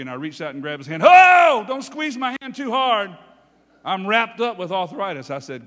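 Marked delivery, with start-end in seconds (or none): 1.0-5.3 high, loud yelp easing into protest; pained complaint. Retold or imitated. Old man protests preacher's handshake, pained loud yelp. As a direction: yelp as if your sore hand is gripped. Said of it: "he was complaining about his health".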